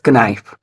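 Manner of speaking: knife with the K sounded, "kuh-NIFE"; knife as spelled. Shown as a demonstration. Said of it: "If there was spell to sound".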